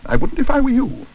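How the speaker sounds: high-pitched cautionary woman; warning. A narrator's reading. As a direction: warn them off sharply. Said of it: "warned Missus Bird".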